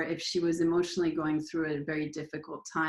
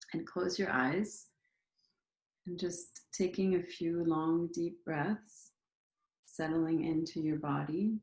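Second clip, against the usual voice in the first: unhurried meditation leader, pausing after each prompt; guided meditation. Acted. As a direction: guide listeners gently into their bodies, leaving space between phrases.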